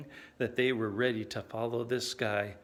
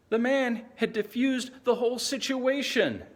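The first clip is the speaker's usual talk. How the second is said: high-pitched, astonished exclamation; amazed disbelief; 10 semitones higher than usual. Acted. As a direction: lift it high, astounded by his skill. Amazed at rival's clever answer.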